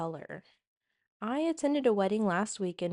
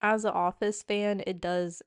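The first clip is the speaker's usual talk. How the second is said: usual speaking voice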